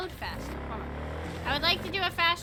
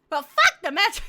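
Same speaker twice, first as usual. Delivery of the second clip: loud playful outburst; mock rant; 3 semitones higher, 9 dB louder than usual. Real, not acted. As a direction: blurt it loud, half joking. Ranting at the game.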